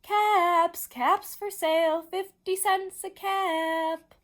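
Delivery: loud, high street vendor's cry; sing-out peddler's sales call. A narrator's reading. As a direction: call your wares down the street, drawing out the words. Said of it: "he walked back to town calling".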